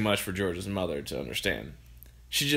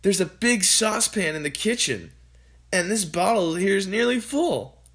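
put-on higher, eager boy; boy's voice; 9 semitones higher, 3 dB louder than usual. A narrator's reading. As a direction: answer brightly as George. George continues.

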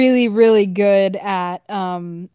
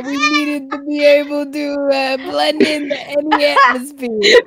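fake Australian accent; 4 semitones higher than usual. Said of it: "you really gotta learn the Australian accent".

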